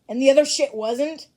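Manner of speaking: loud, indignant retort. Acted. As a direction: fire it back, outraged. Indignant chat reply.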